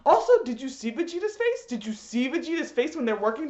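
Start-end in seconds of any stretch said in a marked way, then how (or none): none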